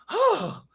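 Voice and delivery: very high, imitated gasp; startled gasp. Lady who stops and turns around, high imitated gasp. Lady turning round after near crash.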